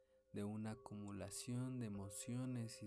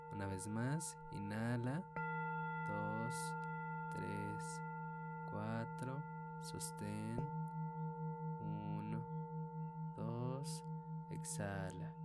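slow, spaced counting of breaths; slower than usual. Shown as a demonstration. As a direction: count each breath calmly and slowly. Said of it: "counting breaths in a meditation". (conteo lento de respiraciones)

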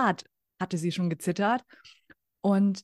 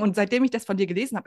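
ordinary delivery

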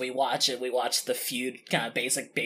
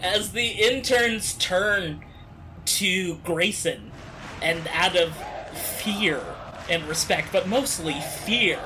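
game master scene-setting; 5 semitones higher, 4 dB louder than usual. Acted. Game master narrating the interns turning on Jerry, dramatic scene-setting.

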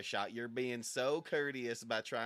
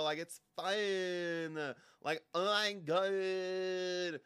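exasperated drawl; 7 semitones higher, 4 dB louder, much slower than usual. Acted. Drawn-out exasperated sigh words.

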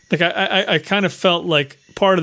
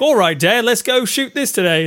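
higher-pitched attempt at an English accent; put-on English accent, pitched up; 5 semitones higher than usual. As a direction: play an eager English lad talking to Dad, in a put-on English accent. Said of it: "is that a good English accent".

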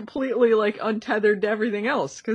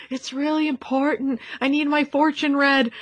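higher-pitched, hammed-up plea; imitating bad acting; 5 semitones higher than usual. Customer urging fortune tellers to read for her, bad acting. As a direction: play a badly acted customer begging. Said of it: "The acting was so bad".

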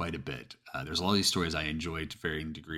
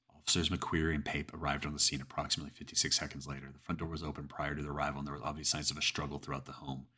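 plain reading voice

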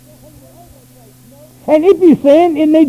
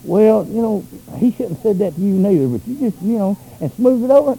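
soft smoothing-over tone; 5 semitones lower, 6 dB quieter than usual. Retold or imitated. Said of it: "I can't pat you on the back and say".

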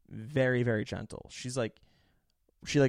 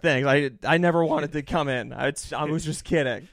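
louder, pained mock cheer; loud mock bravado; 3 semitones higher, 7 dB louder than usual. Insisting to the doctor he's fine, loud and forced. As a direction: fake it loudly through the pain. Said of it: "And I'm like".

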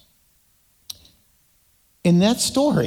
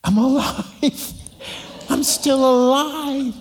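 slow, higher, laughing with relief; relieved laugh; 6 semitones higher, slower than usual. Retold or imitated. Boy laughing in relief that father forgave him. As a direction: breathe out the words like a boy who got away with it. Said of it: "And I'm like".

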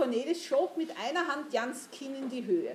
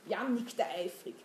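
usual speaking voice

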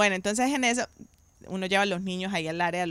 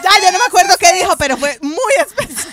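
louder, higher, fast gushing; excited gush; 10 semitones higher, 12 dB louder than usual. Real, not acted. Telling the hosts how special the surprise was, excited fast gush. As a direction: spill it out still thrilled. Still overcome recalling surprise.

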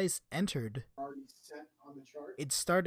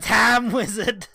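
anime card callout; 5 semitones higher, 8 dB louder, much slower than usual. Retold or imitated. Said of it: "anime card callout".

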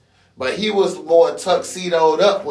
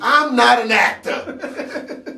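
high, slow, laughing protest; 9 semitones higher, slower than usual. Real, not acted. Laughs off accent criticism.

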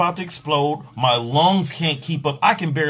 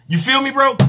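high appeal; 9 semitones higher, 3 dB louder than usual. Real, not acted. Appeal to listener.

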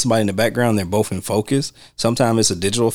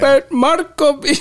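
much higher, slow mock Italian; mock Italian name; 4 dB louder, slower than usual. Imitating the guy who said his name, high mock Italian accent. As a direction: draw out a garbled Italian name, high-pitched. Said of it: "when the guy said his name".

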